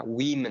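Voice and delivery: "women" said as "WIM-in"; plural pronunciation model. Shown as a demonstration. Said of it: "từ này phát âm là women".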